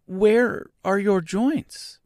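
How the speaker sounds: put-on high, slow, anxious girl's question. A narrator's reading. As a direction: ask anxiously, pitched well up. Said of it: "asked anxiously".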